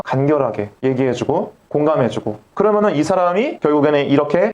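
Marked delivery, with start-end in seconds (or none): none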